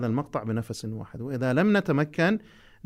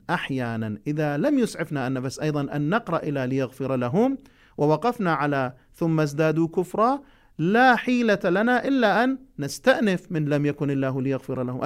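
ordinary talk, nothing marked

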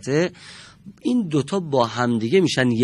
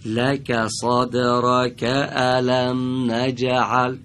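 لک صدرک الم نجعل read slowly, linked; slow connected reading; 4 dB louder, much slower than usual. Shown as a demonstration. Teacher modeling connected reading, slow. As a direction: join words smoothly, slowly. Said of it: "به وصل بخونیم".

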